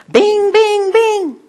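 sing-song high bings like a flasher; high beacon bings. Retold or imitated. Preacher showing his light at the office, high bings. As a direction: chime them out like a little light.

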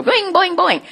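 playful boing. Retold or imitated. Playful light going out.

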